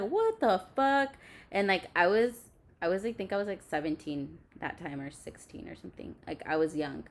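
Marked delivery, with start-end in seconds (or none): 0.0-1.1 high, drawn-out incredulous exclamation; retold disbelief. Girl reacting as boyfriend nearly breaks the bathroom door, drawn-out disbelief. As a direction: stretch it out slowly in re-enacted shock.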